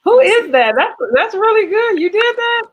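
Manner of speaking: pleasantly shocked praise; surprised delight. Real, not acted. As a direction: gasp with delighted surprise at the painting. Host sees painting, surprised.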